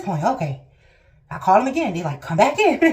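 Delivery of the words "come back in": high, loud mock call-in; exaggerated retold summons. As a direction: imitate staff calling out, played up for laughs. Retold hospital staff on phone.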